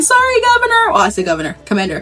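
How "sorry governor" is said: high-pitched pretend scream; comic shrieked apology; mock screaming. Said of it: "the screaming is saying".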